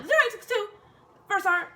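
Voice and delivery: high, loud, nervous repeat; nervous stutter. Retold or imitated. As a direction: force the numbers out scared. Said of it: "I swear I stutter".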